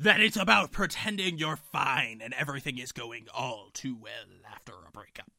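pirate voice fading to a hush; hammed-up pirate trailing into a whisper. Co-host reading a tweet about breakups, pirate voice. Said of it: "you're still using your pirate voice".

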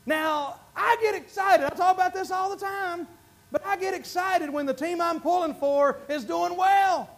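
normal talking voice

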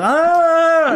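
sudden high loud yelp; mock startled yelp. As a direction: jump in a fake fright. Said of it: "And I start fucking".

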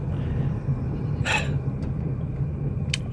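a long, heavy, soft exhale; a deep, weary sigh. Real, not acted. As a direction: let out all the hurt in one sigh. Pause after painful memory.

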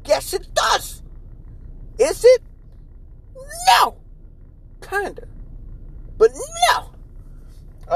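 squeaky high put-on voice; silly voice. Answering his own joke question, a squeaky falsetto. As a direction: answer himself in a goofy falsetto. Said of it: "does that sound sexual".

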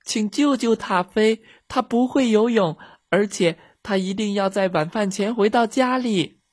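put-on high-pitched child's plea; loud calling. A narrator's reading. Girls begging for the dog's rescue, calling loudly. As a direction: plead for help in a high, urgent voice. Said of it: "米莉和茉莉大声喊".

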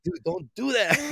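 startled yelp breaking into laughter; jump, then burst out giggling; scream into giggles. Real, not acted.

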